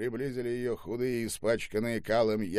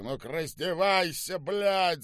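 raised, high-pitched order; angry yell; 7 semitones higher than usual. A narrator's reading. General yells at the old woman, angry command. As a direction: yell the command with mounting anger. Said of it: "закричал генерал".

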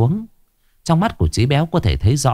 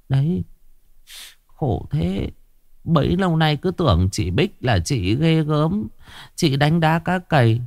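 higher, pitying village woman's voice; sniffling gossip woman; 7 semitones higher than usual. Acted. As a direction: cluck sympathetically at the scene. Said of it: "mấy mụ đàn bà sụt sùi".

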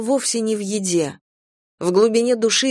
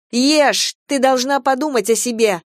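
angry shouting; 6 semitones higher, 3 dB louder than usual. A narrator's reading. Venka forcing girl to eat.

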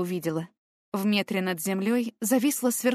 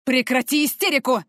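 harsh, louder barked order; barked command; 5 semitones higher, 6 dB louder than usual. Acted. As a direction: snap her out of it. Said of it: "рявкнул мужчина".

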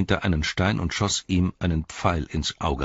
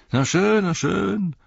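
much higher, drawn-out orc backdown; mock placating; 6 dB louder, slower than usual. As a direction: back off in a high orc voice. Tracker placates bellowing soldier.

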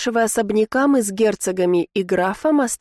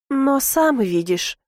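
higher, slow, a touch tearful; near tears; 5 semitones higher, slower than usual. A narrator's reading. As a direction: draw it out, as if close to crying. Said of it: "чуть не расплакалась Карина". (чуть не плача, протяжно)

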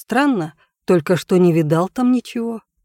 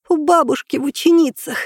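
breathed out; 9 semitones higher than usual. A narrator's reading. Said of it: "выдохнула она".

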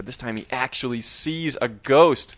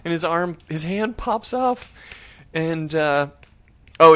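usual speaking voice

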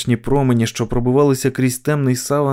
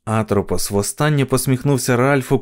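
usual speaking voice

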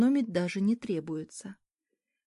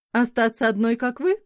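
snide jab; 3 semitones higher, 7 dB louder than usual. Acted. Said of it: "ехидно бросила".

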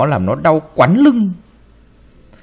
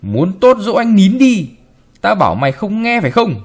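shouting; 7 semitones higher, 3 dB louder than usual. A narrator's reading. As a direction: bellow at the servant girl. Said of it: "Bà quát".

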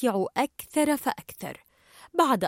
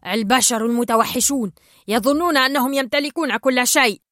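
indignant shout; 6 dB louder than usual. Acted. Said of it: "فصاحت شام".

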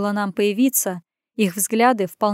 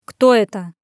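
louder rumbling question; rumbling demand; 6 dB louder than usual. Acted. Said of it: "пророкотал мужчина".

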